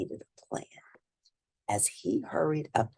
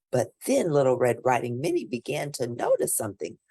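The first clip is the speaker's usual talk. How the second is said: ordinary delivery, nothing marked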